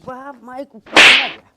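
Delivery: put-on loud, slurred drunk shouting; town drunk. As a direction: bellow it like a staggering drunk. Drunk husband yelling again. (नशे में धुत चिल्लाता शराबी)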